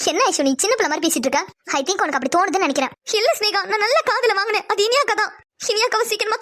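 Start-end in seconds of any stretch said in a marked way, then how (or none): none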